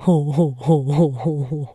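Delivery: deep amused ho ho ho; low chuckle. Acted. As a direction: grandfather chuckling at the frog. Philémon laughs at the frog.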